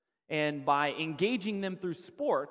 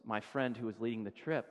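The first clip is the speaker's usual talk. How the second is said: ordinary talk, nothing marked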